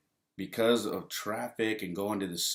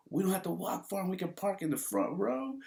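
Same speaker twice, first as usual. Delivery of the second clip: giddy delight; 12 semitones higher than usual. Retold or imitated. Man to mom about front-row parking, giddy. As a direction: squeal it lightly, rising at the end.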